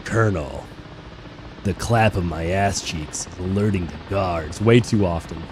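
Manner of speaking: low Solid Snake codec voice. Acted. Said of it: "do the snake voice so well".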